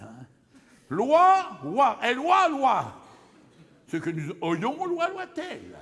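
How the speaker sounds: high, loud, gleeful conjugation rant; a comic, giddy recital of the conjugation; excited wordplay. Acted. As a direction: belt out the silly verb forms with glee.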